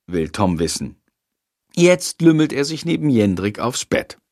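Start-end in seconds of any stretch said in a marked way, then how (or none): none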